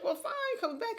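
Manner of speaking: high, sarcastic huff; mock outrage. Patient's partner snaps back at the neurologist in high mock outrage. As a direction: throw it back like an offended patient. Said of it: "this went off".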